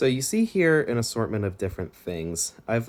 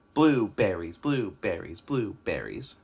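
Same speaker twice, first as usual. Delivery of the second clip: BLUEBERRIES in evenly measured beats; rhythmic demo; 3 semitones lower, slower than usual. Shown as a demonstration. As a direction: chant blueberries steadily, syllable by syllable. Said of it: "say it just like that".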